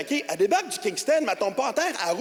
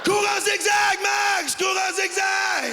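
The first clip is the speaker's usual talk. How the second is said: panicked shout; 12 semitones higher, much slower than usual. Retold or imitated. Warning Max to run from the cop's gun, panicked yell. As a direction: scream a warning to your buddy. Yelling at friend to flee gun.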